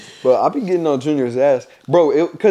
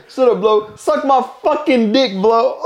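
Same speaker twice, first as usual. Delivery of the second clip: laughing mock insult; 9 semitones higher, slower than usual. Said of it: "It's like".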